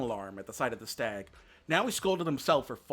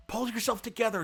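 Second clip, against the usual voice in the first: scolding; 9 semitones higher than usual. A narrator's reading. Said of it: "scolded Bambi".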